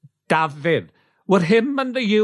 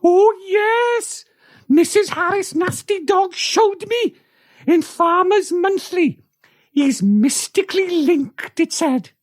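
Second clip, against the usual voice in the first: much higher, eager country wife voice; eager farm wife; 11 semitones higher than usual. Acted. Farm wife telling Geraint about the sheep whisperer, high and eager. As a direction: pitch it well up like an excited farm wife. Radio play farm character.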